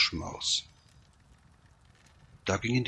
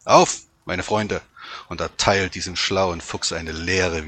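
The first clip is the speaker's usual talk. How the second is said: loud rallying call; commanding dog calling his companions; 7 dB louder than usual. A narrator's reading. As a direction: rouse the pack, voice raised. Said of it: "er rief seinen anderen Hunden zu".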